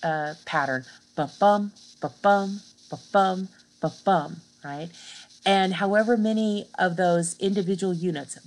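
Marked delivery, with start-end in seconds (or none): 1.2-4.4 light then heavy beat, ba-BUM; teacher sounding out the iamb as a heartbeat; iambic heartbeat. Shown as a demonstration. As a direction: tap out the heartbeat rhythm, unstressed then stressed. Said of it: "the iamb is the heartbeat".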